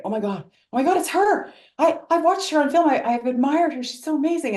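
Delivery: high-pitched fangirl gush, imitating inner panic; starstruck gushing. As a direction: act out being overawed by your idol. Acting out starstruck thoughts on meeting idol.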